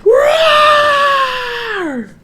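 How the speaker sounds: very high, loud tiny-creature roar; huge roar. A narrator's reading. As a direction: roar with all your might. Blue Blue's big roar.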